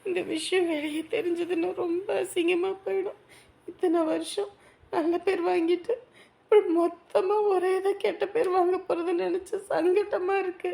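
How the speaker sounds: quiet weeping. Acted. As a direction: whimper out the shame. Same girl, still weeping. (காதலனிடம் மெல்லிய அழுகைக் குரலில்)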